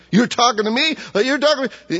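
high-pitched incredulous mock retort; rude woman. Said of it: "she's rude".

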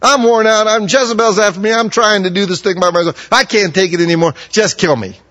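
loud, high, exasperated wail; worn-out outcry. Acted. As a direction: cry out like a prophet at the end of his rope. Elijah's exhausted despair voiced.

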